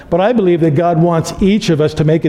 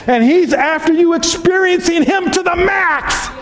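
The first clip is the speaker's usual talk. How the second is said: preaching shout; 12 semitones higher than usual. Real, not acted. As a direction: lift it into a full pulpit shout. Preacher climaxing the point.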